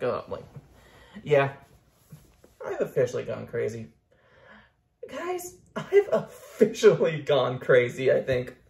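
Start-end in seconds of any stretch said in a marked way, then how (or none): 5.1-8.6 laughing confession. Real, not acted. Laughing admission.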